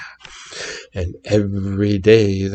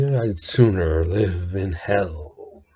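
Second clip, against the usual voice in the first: homely drawl; 5 semitones lower, 8 dB quieter, slower than usual. A narrator's reading. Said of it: "in his homely way".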